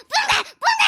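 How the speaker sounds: high, frantic cries; screaming. Acted. Girl still driving people away, screaming. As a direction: shriek them out of sight. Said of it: "கத்தாதம்மா".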